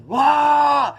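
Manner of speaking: high, loud scream of pain. Retold or imitated. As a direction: yell out like the IV burns like fire. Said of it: "Screamed at the top of my lungs".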